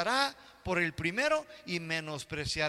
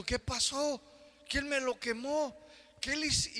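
higher, bewildered complaint; dismayed; 7 semitones higher than usual. Retold or imitated. Man discovering his wool suit eaten, indignant and higher. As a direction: squeal in disbelief over the ruined suit.

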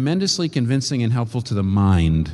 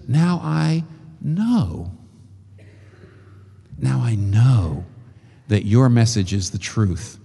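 earnest realization; slower than usual. Acted. The widow's real words.

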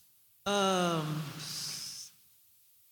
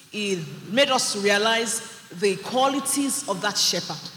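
ordinary talk

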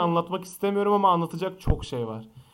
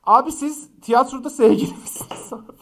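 high, loud nosy fan; mocking fan voice; 10 semitones higher, 9 dB louder than usual. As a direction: shout it like a gossiping fan. Reading fans' question mockingly.

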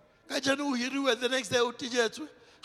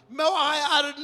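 usual speaking voice

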